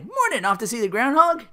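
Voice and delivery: high, loud greeting voice; chatty passer-by. Retold or imitated. Hotel guest greeting Phil in passing, high and chatty.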